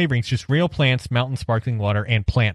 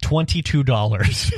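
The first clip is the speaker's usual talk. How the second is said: laughing; 3 semitones higher than usual. Real, not acted.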